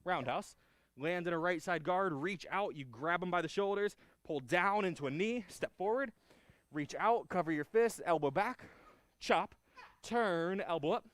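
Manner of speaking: loud, high drill calls; sharp martial arts commands. Shown as a demonstration. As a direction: bark out each move of the form. Calling the form moves.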